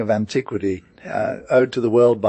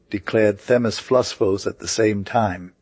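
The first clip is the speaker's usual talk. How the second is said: plain reading voice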